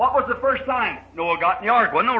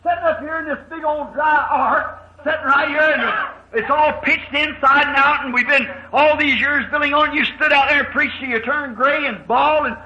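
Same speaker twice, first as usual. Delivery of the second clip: higher-pitched, pleading complaint; nagging son; 4 semitones higher than usual. Acted. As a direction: complain to Dad in a raised, insistent voice. Son nags Noah inside the ark.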